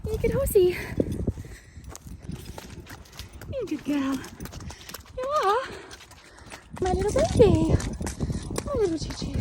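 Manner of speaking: high, sing-song pet praise. Real, not acted. Rider praises her horse after the hill, high sing-song baby talk.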